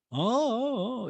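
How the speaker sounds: drawn-out listening oohs, swooping down; Mauricio. Retold or imitated. Podcaster mimicking Mauricio pretending to listen, crooned ohs. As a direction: croon mock acknowledgments. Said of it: "makes a sound to let you know he's listening".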